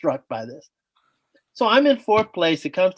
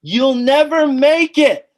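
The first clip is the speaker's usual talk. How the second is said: heckling voice; 9 semitones higher, 6 dB louder, slower than usual. Retold or imitated. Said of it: "this voice goes".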